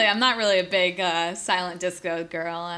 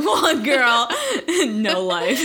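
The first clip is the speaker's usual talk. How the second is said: laughing; 6 semitones higher, 5 dB louder, much slower than usual. Real, not acted. Joking, laughing together.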